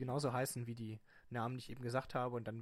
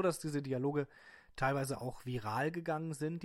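ordinary delivery

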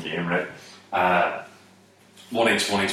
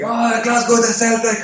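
high, loud fan yell; shouting over; 14 semitones higher, 5 dB louder than usual. Retold or imitated. Russian fellas shouting over from their camper van, high cheer. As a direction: holler it across as an excited supporter. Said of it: "they shouted over".

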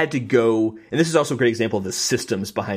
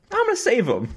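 mock heroic resolve; 12 semitones higher than usual.

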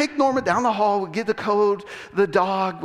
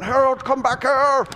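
higher mock holler after a runaway; imitated calling out; 6 semitones higher, 4 dB louder than usual. Obadiah chases naked Harold down the hall, imitated shouting. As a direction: call him back at raised pitch.

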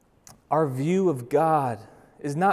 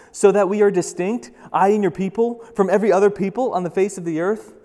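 higher, louder, urgent plea; impassioned scripture reading; 5 semitones higher, 7 dB louder than usual. A narrator's reading. Moses intercedes for his people, impassioned reading. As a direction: read it out as a forceful appeal. Reads Moses pleading with God.

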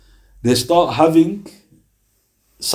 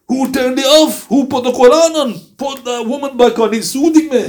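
dying man's demand; 10 semitones higher than usual. Acted. Dying man begging his family to bring the music back, high and indignant.

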